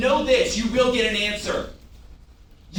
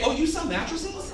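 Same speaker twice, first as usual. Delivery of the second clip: high, soft questioning voice; mock-innocent rising question; surprised guest; 7 semitones higher, 3 dB quieter than usual. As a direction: lift it high, lightly, as a puzzled customer.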